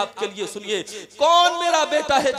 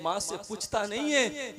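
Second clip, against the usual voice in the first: ordinary talk, nothing marked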